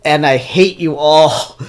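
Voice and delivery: loud, high mock outrage; playful angry rant at viewers. Real, not acted. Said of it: "I'm sorely disappointed".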